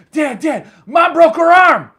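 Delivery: high, full-voiced shout imitating a kid; son yelling for Dad. Son runs up to dad with bad news, yelling. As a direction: holler the news like an alarmed son.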